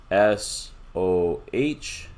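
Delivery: S O H read letter by letter, very slowly; spelling out letters. Shown as a demonstration. Teacher slowly spelling the acronym letters for students. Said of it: "So you see here".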